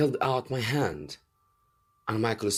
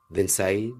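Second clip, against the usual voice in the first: ordinary delivery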